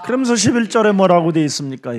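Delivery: ordinary delivery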